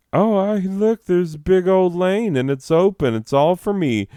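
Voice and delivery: high, loud, gleeful idiot voice; dopey high-pitched impression of an entitled driver; mocking dipshit. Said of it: "this fucking dipshit is like".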